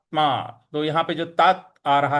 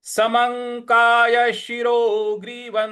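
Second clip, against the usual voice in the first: slow higher chant of full line; model recitation; 5 semitones higher, slower than usual. Shown as a demonstration. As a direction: recite the whole line clearly. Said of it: "इस प्रकार से बोलेंगे". (पूरी पंक्ति का आदर्श पाठ)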